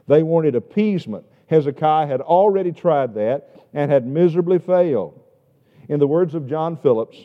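loud, driving sermon delivery; booming pulpit preaching; full-force preaching. Acted. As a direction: press the point home to the pews.

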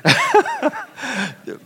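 a burst of high real laughter. Real, not acted. Preacher laughing at his own cud joke.

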